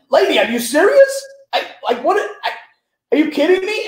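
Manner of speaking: high-pitched, incredulous mock outrage; belittling indignation. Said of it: "he's, like, just belittling her".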